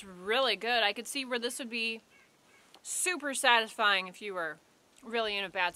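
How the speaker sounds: eating a dry cracker; talking while chewing. Real, not acted. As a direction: mouth partly full of food. Seen 1: Holds pale bar throughout, bites at +2.5 and +3.3, chews while talking.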